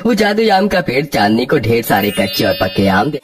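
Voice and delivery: ordinary delivery, nothing marked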